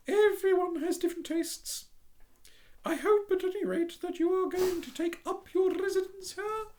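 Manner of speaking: prim little duck voice, high. Acted.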